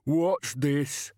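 big simple man, roused and puzzled; slow-witted giant. A narrator's reading. Slow giant Banjo voiced in story.

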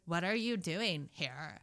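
slow, soft mock socialite; Kardashian parody. Parody sister questioning another, slow soft socialite voice. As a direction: drag the words out quietly. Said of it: "they pan to you and it's like".

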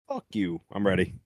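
ordinary talk, nothing marked

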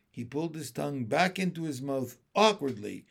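plain reading voice

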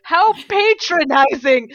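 fuming at being patronized, loud and slow; outraged. Real, not acted. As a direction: blast the word patronizing in disbelief. Outraged at the cheering.

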